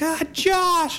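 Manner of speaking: put-on high squealing drunk greeting; drunk friend's squeal. Drunk acquaintance greets Josh at a party, high squeal. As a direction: squeal the name like a tipsy friend spotting you. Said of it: "she's like".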